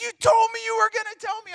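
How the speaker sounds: put-on mad kid brother. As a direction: yell it like a betrayed little brother. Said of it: "he was so mad".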